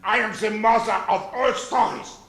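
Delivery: bawdy nursery tale in mock German; put-on German-accented storyteller. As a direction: tell it with a hammed-up German accent. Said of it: "Tell the real story of Mother Goose in this accent".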